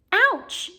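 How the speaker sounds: high, loud sudden yelp; gasped ouch. Acted. Hermione bumps into something in the dark and yelps. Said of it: "gasped Hermione".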